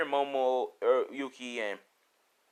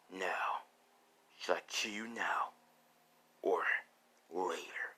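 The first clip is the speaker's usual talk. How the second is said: low, whispery menace; hushed, deliberate knifepoint question; quiet threat; 6 semitones lower, 10 dB quieter, slower than usual. Acted. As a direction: toy with them softly. Threatens with blade at necks.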